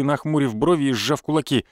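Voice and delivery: usual speaking voice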